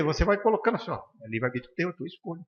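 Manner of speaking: ordinary talk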